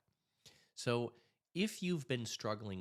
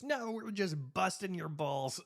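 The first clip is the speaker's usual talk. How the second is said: teasing cop; 11 semitones higher than usual. Retold or imitated. Cop laughing at joke.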